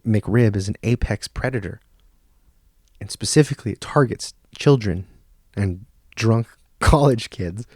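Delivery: ordinary delivery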